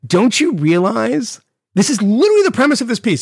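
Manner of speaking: high-pitched, incredulous challenge; exasperated disbelief. Retold or imitated. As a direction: push it up in pitch, astonished at the obvious. Incredulous reaction to the article.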